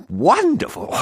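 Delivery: high, breathless exclamation; delighted gasp. A narrator's reading. Smitten farmer hears she comes at midnight, high gasp. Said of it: "gasped Fendleton".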